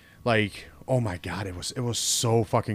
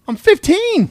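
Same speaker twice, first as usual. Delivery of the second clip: high, loud indignant cry; loud protest; 10 dB louder, slower than usual. Retold or imitated. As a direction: blurt it out in disbelief. Protesting to judge in retold scene.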